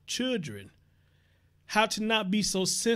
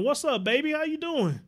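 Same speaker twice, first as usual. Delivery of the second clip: excited greeting; 8 semitones higher, 4 dB louder than usual. Real, not acted. Greeting viewer in the chat.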